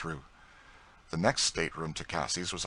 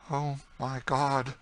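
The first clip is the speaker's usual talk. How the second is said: high, slow, stricken exclamation; stunned cry, drawn out and high; overwhelmed shock; 8 semitones higher, slower than usual. A narrator's reading. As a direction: gasp it out slowly, about to faint. Shocked woman about to faint.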